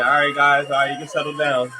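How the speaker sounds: loud, high host calming an imaginary crowd; mock stage host; hushing the crowd. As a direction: settle the pretend audience like a showman.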